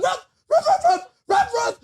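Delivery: very high yapping barks; dog barks. Retold or imitated. Singer barking at him mid-song, high and yappy. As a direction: bark like a little dog. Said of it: "she went".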